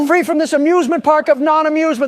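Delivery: squeaky, very high and loud; helium voice. Real, not acted. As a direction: shriek with joy in a tiny high voice. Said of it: "Pardon my helium voice".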